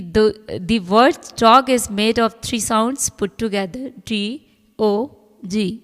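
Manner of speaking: reading the rule aloud very slowly. Acted. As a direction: dictate each word slowly to the class. Reads English text slowly. (नियम को बहुत धीरे-धीरे पढ़कर सुनाना)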